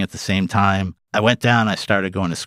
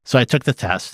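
ordinary talk, nothing marked

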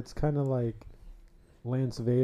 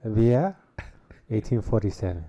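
put-on French accent; 7 dB louder than usual. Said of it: "your best French accent".

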